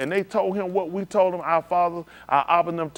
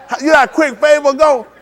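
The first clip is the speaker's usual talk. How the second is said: excited; 11 semitones higher, 12 dB louder than usual. Retold or imitated. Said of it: "I was like".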